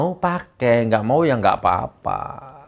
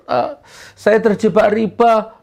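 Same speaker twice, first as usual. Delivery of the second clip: mock follower; 9 semitones higher, 3 dB louder than usual. Dialog jamaah dan Gus Nur.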